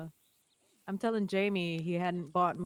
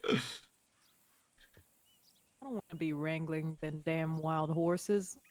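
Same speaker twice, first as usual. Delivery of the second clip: ordinary talk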